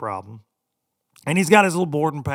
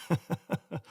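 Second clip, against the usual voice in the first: soft laugh; 4 semitones higher, 8 dB quieter than usual. Real, not acted. Laughing at own story.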